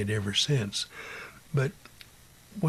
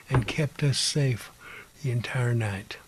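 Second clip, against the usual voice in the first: ordinary talk, nothing marked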